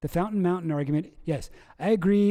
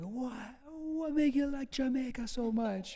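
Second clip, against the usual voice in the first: a hint of a Jamaican accent, higher; lightly voiced Jamaican interviewer; higher, light Jamaican patois quote; imitating Jamaican guy; 8 semitones higher, 7 dB quieter than usual. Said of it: "the guy's like".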